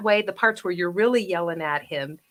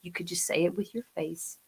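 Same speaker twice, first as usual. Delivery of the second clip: much softer, low and pointed; quiet intensity; 3 semitones lower, 14 dB quieter than usual. Shown as a demonstration. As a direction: pull it right down. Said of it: "something can be really intimidating".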